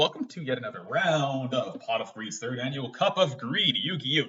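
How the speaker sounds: big tournament host opener; hyped-up game-show host intro; announcer. Acted. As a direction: welcome the crowd like a show announcer.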